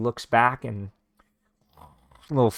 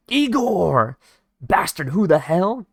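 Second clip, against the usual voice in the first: loud, high furious yell; enraged shout; 7 semitones higher, 5 dB louder, slower than usual. Retold or imitated. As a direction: scream at the unseen killer. Bandit sees his friend killed.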